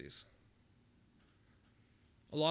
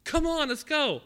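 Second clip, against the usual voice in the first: much higher and louder, bursting with news; excited servant; 10 semitones higher, 10 dB louder than usual. Acted. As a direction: announce the homecoming with thrilled energy. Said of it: "the servant just really excited".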